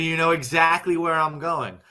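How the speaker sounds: ordinary talk, nothing marked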